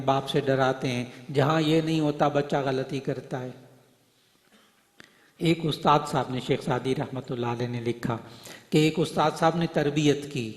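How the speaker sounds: ordinary talk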